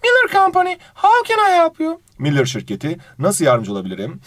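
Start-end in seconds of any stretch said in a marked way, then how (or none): 0.0-2.0 much higher put-on female receptionist voice; woman on the phone. Receptionist answering a business phone call, high-pitched female voice. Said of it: "burada bir bayan konuş".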